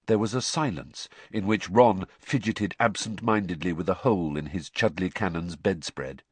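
usual speaking voice

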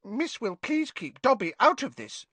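high, nervous little elf voice; scared Dobby. Acted. Dobby scared, begging Hermione to leave him out. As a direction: beg to be left out, frightened. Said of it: "Dobby mumbled, looking scared".

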